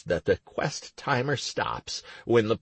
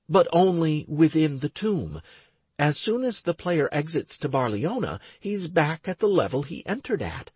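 lightly raised woman's voice; young woman voice; 6 semitones higher than usual. Acted. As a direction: voice Stacy a little higher. Stacy voiced by narrator.

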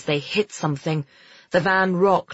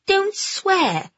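put-on high, reproving girl's voice; pitched way up, bossy; prim scolding; 14 semitones higher than usual. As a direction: tick him off sharply. Girl scolds boy for swearing.

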